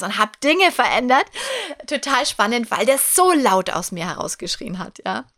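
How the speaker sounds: ordinary talk, nothing marked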